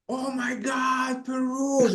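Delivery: high, drawn-out gushing amazement; imitated freak-out. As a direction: gush like an astonished customer losing it. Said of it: "They lose their freaking mind".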